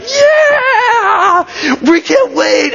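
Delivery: very high cheer, easing slightly; crowd scream. Acted. Crowd running out to meet Jesus, screaming excitedly. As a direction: scream like a fan who can't wait. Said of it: "they start to scream toward Jesus".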